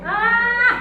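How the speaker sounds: high, sustained "aah" yell; high-pitched shout held on one vowel; demonstrated scream. Shown as a demonstration. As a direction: let out a tension-releasing scream. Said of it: "kalau saya biasanya teriak".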